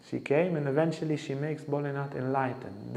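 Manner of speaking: normal talking voice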